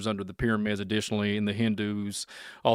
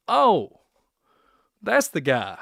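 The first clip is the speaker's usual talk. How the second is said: mock surprise; 5 semitones higher, 5 dB louder, slower than usual. Imagining drive-by onlookers.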